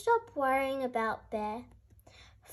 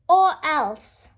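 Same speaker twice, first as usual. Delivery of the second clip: much louder, angry bellow; 3 semitones higher, 9 dB louder than usual. A narrator's reading. Bear bellowing from his cave at the animals, loud. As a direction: roar at the noisy animals outside. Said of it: "Bear bellowed".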